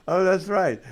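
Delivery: ordinary talk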